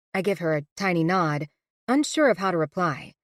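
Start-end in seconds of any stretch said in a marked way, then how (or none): none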